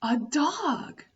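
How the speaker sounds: normal talking voice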